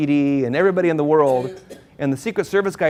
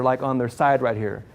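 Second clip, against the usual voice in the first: normal talking voice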